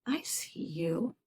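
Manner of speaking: soft slow whisper. Shown as a demonstration. Therapist demonstrating talking to an inner part in a slow whisper. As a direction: whisper it to a hurt part of yourself.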